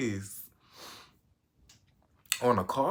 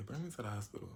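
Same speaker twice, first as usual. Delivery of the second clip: weak mumble; 11 dB quieter, much faster than usual. Retold or imitated. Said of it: "And I was like".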